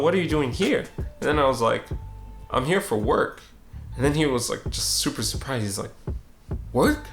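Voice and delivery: ordinary delivery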